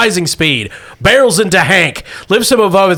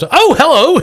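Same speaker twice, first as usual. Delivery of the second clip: caught off guard; 12 semitones higher, 5 dB louder than usual. Acted. GM voices startled Marca.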